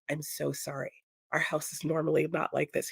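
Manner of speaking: to the phone guy, softly apologising; hushed apology. Retold or imitated. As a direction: say it low so the family won't hear. Apologising quietly to guest.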